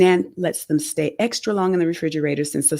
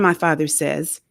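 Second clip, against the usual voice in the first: usual speaking voice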